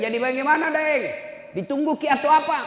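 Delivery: high, loud retold question; insistent questioning. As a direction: press the son for an answer. Ustaz mendesak anak lewat telepon. (pertanyaan mendesak dengan suara tinggi)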